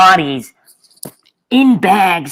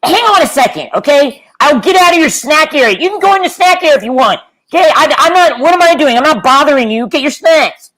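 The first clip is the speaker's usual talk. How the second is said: high-pitched, harried call; exasperated calling out; 6 semitones higher, 3 dB louder than usual. Acted. Telling others they can get their snacks, exasperated call. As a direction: call over to bystanders, increasingly exasperated.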